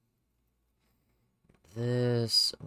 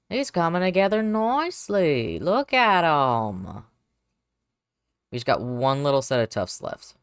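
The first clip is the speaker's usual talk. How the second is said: loud, proud cooing over the model; excited gushing; 3 semitones higher, 9 dB louder than usual. Real, not acted. Admiring her sculpt, loudly gushing over it. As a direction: gush over the little creature.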